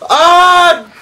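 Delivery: short high shriek of real fright; startled scream. Real, not acted. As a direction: yelp as something jumps out. Sudden scare in horror game.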